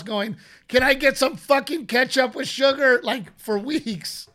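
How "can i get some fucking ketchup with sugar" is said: higher, louder mock yell through the house; retold yelling. Husband retells yelling for sugary ketchup at home, mock yell. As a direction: holler it like a fed-up man wanting ketchup. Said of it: "yelling in the house".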